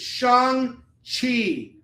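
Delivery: "Shang-Chi" as SHAHNG... CHEE, very slow and high; correct pronunciation. Shown as a demonstration. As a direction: draw out both syllables of "Shang-Chi", open "ah". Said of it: "not Shang-Chi".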